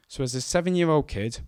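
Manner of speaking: ordinary delivery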